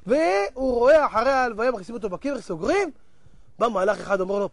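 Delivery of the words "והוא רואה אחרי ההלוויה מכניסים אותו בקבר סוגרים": ordinary talk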